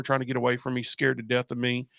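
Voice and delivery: ordinary delivery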